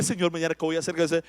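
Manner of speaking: anxious worrier. Retold or imitated. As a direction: rush the worried question under the breath. Imitating an anxious prayer. (imitando una oración ansiosa, rápida y baja)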